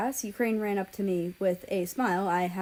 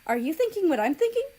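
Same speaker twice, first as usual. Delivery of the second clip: much higher, louder, fast eager question; sudden excited scheming; excited idea; 10 semitones higher, 5 dB louder, faster than usual. A narrator's reading. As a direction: burst out with the bright idea. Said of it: "Japan gasped".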